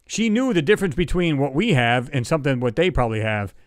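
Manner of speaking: ordinary talk, nothing marked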